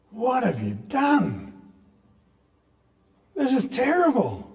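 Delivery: high, slow, dismayed voice of God; a shocked father's rebuke, pitched high; imagined God confronting. Retold or imitated. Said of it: "come at Adam and Eve and say".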